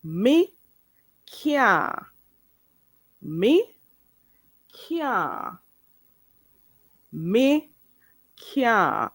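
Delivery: "Me can't" word by word, as "mi... kyah"; Patois pronunciation drill. Shown as a demonstration. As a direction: repeat "Me can't" with long pauses, can't as "kyaa". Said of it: "I'm gonna repeat myself".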